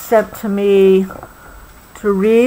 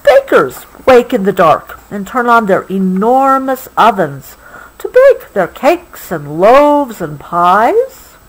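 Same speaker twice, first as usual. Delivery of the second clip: lively storybook reading, slowing at the ends; story reading; 3 semitones higher than usual. A narrator's reading. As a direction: paint the night town for a child. Reading book text.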